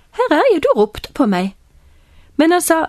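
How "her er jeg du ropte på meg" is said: high opening that drops to normal; boy answering a call. Acted. Boy has run in to priest Eli, high eager reply falling away. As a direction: pipe up, then settle as you explain.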